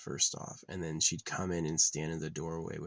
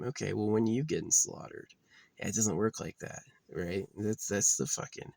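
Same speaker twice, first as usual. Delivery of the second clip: usual speaking voice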